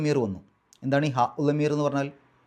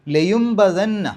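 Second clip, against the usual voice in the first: slow, louder chanted recitation; Quranic recitation; 4 semitones higher, 5 dB louder, much slower than usual. Shown as a demonstration. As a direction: recite ലയുംബദന്ന slowly and melodically. Recites the verse.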